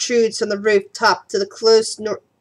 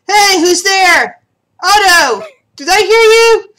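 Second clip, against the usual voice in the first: higher, louder guard calling out; yell; 6 semitones higher, 5 dB louder, slower than usual. Acted. Guard yelling from the gatehouse for his friend. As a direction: call out slowly for a lost friend. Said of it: "you hear somebody yell".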